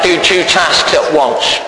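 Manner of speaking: ordinary talk, nothing marked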